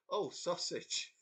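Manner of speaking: slow, drawn-out dazed blurt. Retold or imitated. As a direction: let it slip out slowly, half asleep. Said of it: "but I went".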